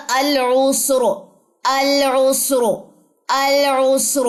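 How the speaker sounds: العسر recited slowly with a heavy sakin ra; heavy ra after damma. Shown as a demonstration. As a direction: stretch العسر, keeping the ra thick. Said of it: "اس طریقے سے را جو ہے وہ موٹی پڑی جائے گی".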